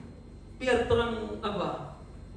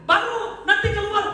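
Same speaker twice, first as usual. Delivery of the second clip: high, loud scolding sermon with mock cries; full-force preaching; 11 semitones higher, 6 dB louder than usual. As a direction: preach loudly, mimicking complainers. Preaches, acting loud cries of hunger.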